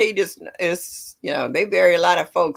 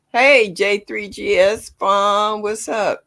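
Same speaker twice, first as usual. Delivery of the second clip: drawn-out loud greeting to a viewer; calling out; 4 semitones higher, 7 dB louder, slower than usual. Real, not acted. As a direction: stretch the name out, calling across the room. Greeting a viewer in live chat.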